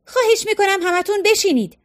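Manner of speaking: high-pitched plea for everyone to sit; shouting. A narrator's reading. Maggie yells at the onlookers to sit, shouting. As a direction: call out over the crowd, pleading. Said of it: "فریاد زد".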